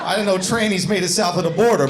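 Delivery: ordinary delivery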